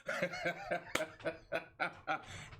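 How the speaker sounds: a short airy chuckle to himself. Real, not acted. Speaker amused at his own rant, airy chuckle. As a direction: laugh it off under your breath.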